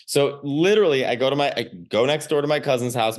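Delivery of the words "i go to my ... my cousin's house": usual speaking voice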